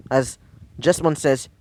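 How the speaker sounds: usual speaking voice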